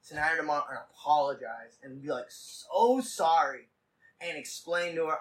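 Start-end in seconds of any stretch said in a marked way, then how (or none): 2.3-3.7 SO stretched long in a rehearsed apology; slow, emphatic "So sorry" with a long SO; drawn-out SO. Pretend. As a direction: lean on SO and hold it.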